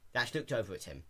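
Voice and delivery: plain reading voice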